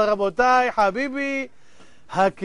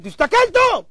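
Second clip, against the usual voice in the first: high, loud scolding command; insistent shout; 10 semitones higher, 5 dB louder than usual. Acted. Townsfolk insisting the tourist look closer, exasperated shout. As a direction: jump up high and shout the order.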